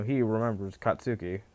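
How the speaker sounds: usual speaking voice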